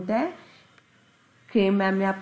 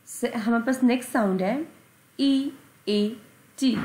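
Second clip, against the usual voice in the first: ordinary delivery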